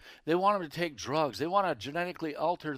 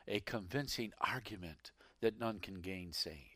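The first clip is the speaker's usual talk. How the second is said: whisper; 7 semitones lower, 10 dB quieter than usual. Acted. Preacher drops to a whisper.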